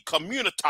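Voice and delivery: community ending in "tah"; altered pronunciation. Real, not acted. Coined term replacing the word community.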